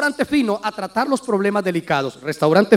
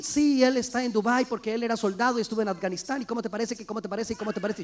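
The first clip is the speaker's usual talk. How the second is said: hushed eager gossip; 2 semitones lower, 10 dB quieter, faster than usual. Retold or imitated. Wife telling news over breakfast.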